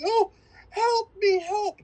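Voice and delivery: high, slow pleading damsel voice; mock damsel in distress. Retelling the movie to a friend, a hammed-up damsel plea. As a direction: whimper it like a helpless horror heroine.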